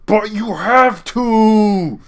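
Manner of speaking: high, slow, pleading insistence; drawn out and whiny; insistent director. Retold or imitated. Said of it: "the voice director was like".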